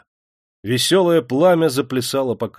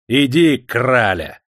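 mocking shout; 6 dB louder, slower than usual. A narrator's reading. Crowd pushing Yulka.

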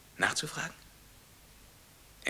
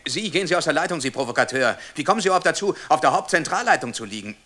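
louder, higher outraged telling-off; indignant scolding; 4 semitones higher, 5 dB louder than usual. Acted. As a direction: chase the intruder off the line. Caller scolds an intruder on line.

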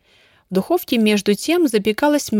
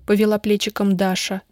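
plain reading voice

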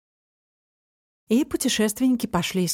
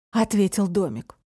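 ordinary delivery

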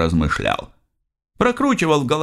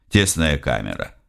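ordinary delivery

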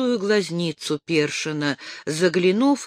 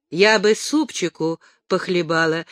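plain reading voice

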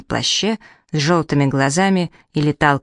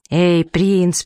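ordinary delivery, nothing marked

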